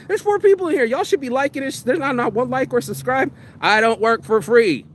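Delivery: mock scolding. Real, not acted. Vlogger scolding his few viewers, high mock complaint.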